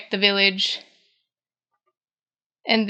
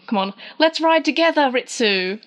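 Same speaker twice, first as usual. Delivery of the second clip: high-pitched, playful urging; lightly acted eager invitation; eager coaxing; 4 semitones higher than usual. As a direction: imitate coaxing a reluctant friend along. Retold eager coaxing to ride along.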